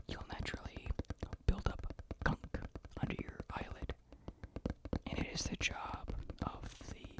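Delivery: soft, voiceless whispered storytelling; ASMR whisper. Real, not acted. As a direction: whisper it gently, with unhurried pauses.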